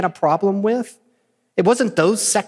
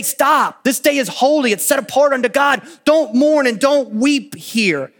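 raised, higher, commanding voice; stern command; 8 semitones higher, 4 dB louder than usual. Retold or imitated. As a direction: order the crowd to stop mourning. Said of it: "They say".

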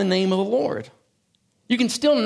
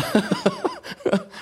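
high giggle. Real, not acted. Laughs about Gilligan digression.

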